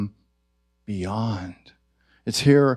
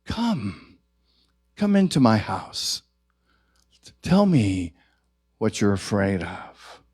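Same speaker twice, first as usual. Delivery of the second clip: tender welcome; 4 semitones lower than usual. Pretend. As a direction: invite fear in like a guest. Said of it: "What if we said".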